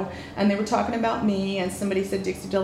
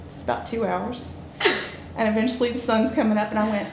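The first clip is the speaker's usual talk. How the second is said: ordinary talk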